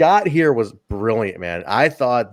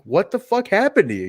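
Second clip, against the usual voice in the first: higher, pointed question; emphatic retelling; 8 semitones higher than usual. Retold or imitated. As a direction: lean into the disbelief. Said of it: "you just feel the character of that moment".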